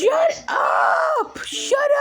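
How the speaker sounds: high, drawn-out cry of protest; high-pitched yell. Acted. Carl yelling at someone to shut up, high-pitched shout. Yelling at interruption in game skit.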